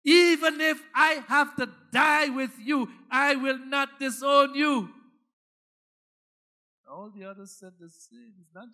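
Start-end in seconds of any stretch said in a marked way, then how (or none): 0.0-4.9 loud, higher, stubborn shout; emphatic insisting. Acted. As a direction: swear it with all force. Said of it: "Peter insisted emphatically".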